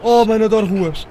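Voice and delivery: overjoyed. Real, not acted. Player finally out on the street, overjoyed. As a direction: shout it out in real delight. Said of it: "Olha a minha felicidade".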